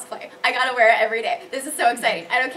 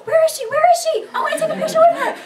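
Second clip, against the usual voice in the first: much higher, loud and fast, laughing; eager fans; 9 dB louder, faster than usual. Retold or imitated. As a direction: squeal like a fan hunting her down.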